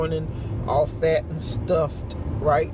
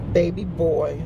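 normal talking voice

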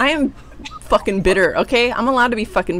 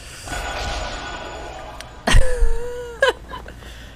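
squealing laughter way up high; high-pitched laugh. Real, not acted. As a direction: shriek of helpless laughter. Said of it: "She just went up about six octaves".

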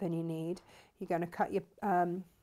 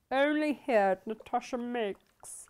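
ad read; 3 semitones higher, slower than usual. Acted. Plugging her own shop.

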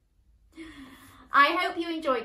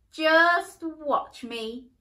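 defiant call, slow; 5 semitones higher, 7 dB louder, much slower than usual. Acted. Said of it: "called the princess".

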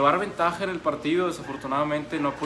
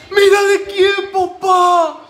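very high, very loud celebration; loud ecstatic yell; 13 semitones higher, 12 dB louder, much slower than usual. Real, not acted. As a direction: bellow it with full joy. Fan celebrating, loudest take.

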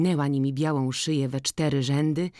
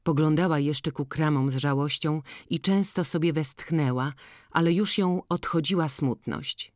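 ordinary talk, nothing marked

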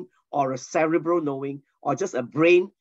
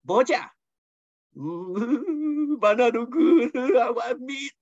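higher mock whine with laughs; whiny Israelites; 7 semitones higher, much slower than usual. Israelites complain to God about manna, mock whine. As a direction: whine for meat, half laughing. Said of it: "Israelites were complaining".

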